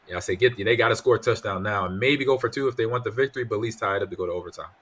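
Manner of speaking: normal talking voice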